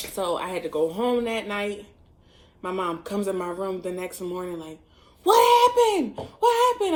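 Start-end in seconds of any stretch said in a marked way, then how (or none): 5.2-7.0 very high, loud imitation of a shocked mom; alarmed outburst. Mom bursting into daughter's room next morning, alarmed outburst. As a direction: shriek the question in mock alarm. Mother's alarmed question, acted.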